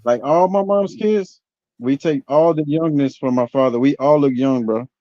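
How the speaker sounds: ordinary delivery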